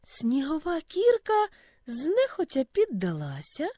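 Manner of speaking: ordinary delivery, nothing marked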